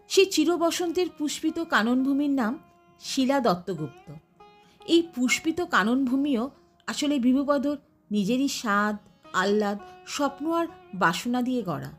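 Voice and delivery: usual speaking voice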